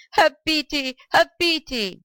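high-pitched, desperate plea; pleading old man, high and urgent; begging. A narrator's reading. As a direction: beg for mercy, pitch well up. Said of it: "begged the old man".

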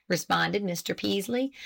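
ordinary delivery, nothing marked

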